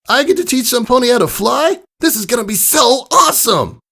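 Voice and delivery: thrilled filly shouting her joy; high cartoon pony whooping with delight; excited Rainbow Dash. Narrator voices Rainbow Dash excitedly.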